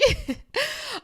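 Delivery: a short, high giggle. Real, not acted. Laughing at her own public apology to Hannah Berner, high giggle.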